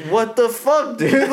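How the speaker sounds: high, let-down whine; dismayed fan. Retold or imitated. Friend mimics Grumpy losing the ball, high let-down whine. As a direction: whine like a kid robbed of candy. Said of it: "Grumpy was just like".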